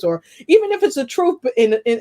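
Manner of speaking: ordinary delivery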